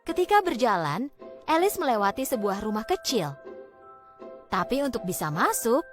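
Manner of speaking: usual speaking voice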